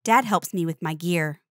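usual speaking voice

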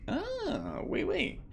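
high mock French oui oui; a hint of a silly French voice, high. Gamer mocking the narrator in a high mock French voice.

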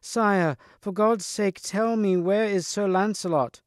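high-pitched, pleading call for help; urgent cry. A narrator's reading. Lady before the king asking for Sir Lancelot, urgent cry.

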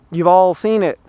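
normal talking voice

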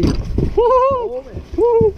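high excited woo-hoo; whooping. Real, not acted. Angler landing a fish, high whooping cheer. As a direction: holler with joy.

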